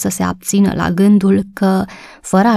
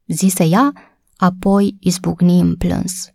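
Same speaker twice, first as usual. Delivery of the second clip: ordinary delivery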